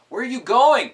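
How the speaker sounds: very high, loud yell; yelled in unison. Acted. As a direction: shout after your running friend. Friends all yelled in unison.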